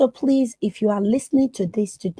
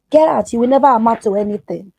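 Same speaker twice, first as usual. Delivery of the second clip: mock angry shout; 4 semitones higher, 8 dB louder, faster than usual. Abuser belittling her, retold.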